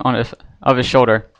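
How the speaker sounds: ordinary delivery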